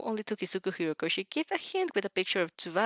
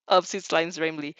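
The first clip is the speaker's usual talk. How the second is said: ordinary delivery, nothing marked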